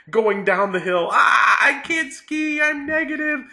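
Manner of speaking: high, loud panicky recap; mock panic. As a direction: shriek it like falling down a slope. Retelling the ski panic.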